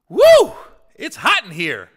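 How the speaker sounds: loud, high woo, then fanning complaint; imitating James' wife. James' wife in the hot club, loud high woo complaint. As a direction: play a sweaty wife blowing off heat. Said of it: "And then James' wife goes".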